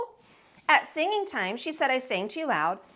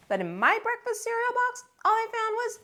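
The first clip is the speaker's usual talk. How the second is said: put-on higher, whining child voice; high whine; 11 semitones higher than usual. A narrator's reading. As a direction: squeak it out in disbelief. Alexander's disappointment, pitched up.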